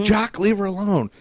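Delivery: high-pitched, loud imitated yell. Arnie defending the runner from the official, yelling. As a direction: call him off with a retold shout. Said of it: "Arnie first started yelling at him".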